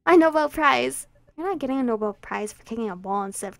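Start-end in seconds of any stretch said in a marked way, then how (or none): 0.0-1.1 gloating boast. Acted. Gloating after trick works.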